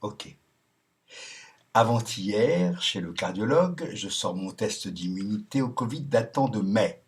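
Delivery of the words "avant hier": ordinary talk, nothing marked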